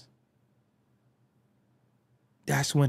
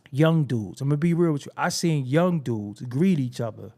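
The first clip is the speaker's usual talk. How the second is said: ordinary talk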